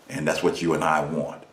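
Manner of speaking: usual speaking voice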